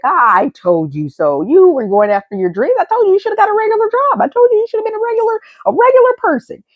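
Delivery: fast, high-pitched I-told-you-so; mock scolding critic. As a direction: imitate a nagging naysayer, rising high and rushing. Voices an imagined I-told-you-so critic.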